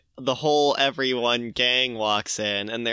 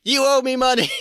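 high, loud screamed demand; screaming; 11 semitones higher, 5 dB louder, faster than usual. Retold or imitated. Archie opening the door to Jughead, screaming. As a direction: yell it at him as the door opens. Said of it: "opens the door screaming that".

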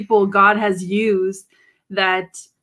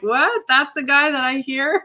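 higher, incredulous question; mock disbelief; 6 semitones higher than usual. Cohost imitating listeners meeting the radio voice, incredulous. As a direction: play up the double take. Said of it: "the image is like".

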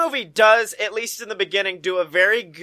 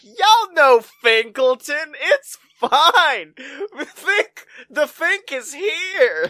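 mock casual announcer; 6 semitones higher, slower than usual. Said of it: "This movie's just like".

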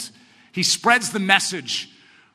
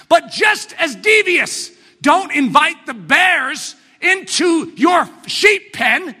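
higher, louder preacher's build to a shout; preaching at full force; 10 semitones higher, 5 dB louder than usual. Acted. Preacher warning his congregation, shouting from the pulpit. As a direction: drive it home like a pulpit climax. Preacher escalates the sheep metaphor.

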